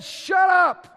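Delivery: loud high rebuke to demons; commanding shout. Retold or imitated. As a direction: bark the rebuke. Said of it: "When Jesus said".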